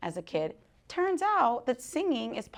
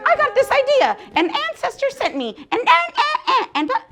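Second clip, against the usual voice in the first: much higher and louder, tumbling excitement; excited gush; 7 dB louder, faster than usual. Retold or imitated. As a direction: blurt it out thrilled. Excited quote of herself.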